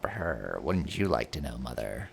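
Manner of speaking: lightly voiced Samuel L. Jackson type; gangster voice. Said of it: "giving her, like, a gangster voice".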